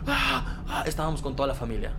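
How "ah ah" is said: high, restrained screams; short high mock screams; imitated scream. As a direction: mimic screaming out without full volume. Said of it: "empecé a gritar así".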